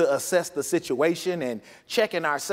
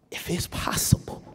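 breathless whisper of begging; whispered plea. Acted. Jesus pleading in the garden, sudden whisper. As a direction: drop to a broken whisper. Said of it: "he's like".

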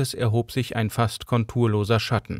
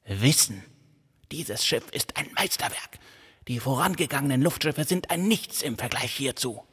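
put-on obsessed old man; 4 semitones higher, 4 dB quieter than usual. A narrator's reading. Erhard praises the ship.

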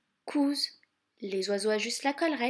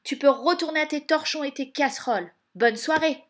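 loud, brisk and haughty; scornful dismissal; 4 semitones higher, 9 dB louder than usual. A narrator's reading. Stepmother banishing Cinderella to her pans, scornful. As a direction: send them off with contempt.

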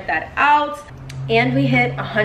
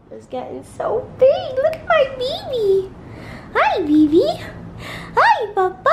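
squeaky praising a dog. Real, not acted. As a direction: coo up high at the dog. Cooing at her puppy.

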